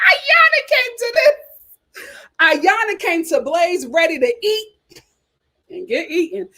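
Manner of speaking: cracking up mid-sentence; laughing. Real, not acted. Host laughing while teasing a reviewer about her date. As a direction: laugh through the words.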